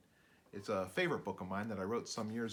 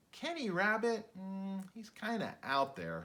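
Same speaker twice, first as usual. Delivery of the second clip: put-on high, slow, doubtful kid; classmate voice; 9 semitones higher, slower than usual. A narrator's reading. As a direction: drag out the doubt like a schoolkid. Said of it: "his classmates would say".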